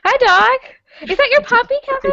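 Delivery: high cooing pet voice. Real, not acted. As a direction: coo at the puppy. Greets puppy on camera.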